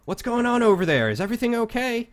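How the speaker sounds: high, loud call across the camp; guard calls out to find out what's happening; distant guard calling out. Acted. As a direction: shout to someone far off. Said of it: "you hear this guy yell out".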